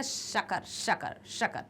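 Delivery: शकर whispered softly with a hushing श; sha sound demo. Shown as a demonstration. Teacher to students, softly modelling शकर. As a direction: breathe out शकर quietly. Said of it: "अब यदि हम शकर बोलते हैं".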